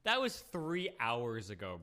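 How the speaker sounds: ordinary delivery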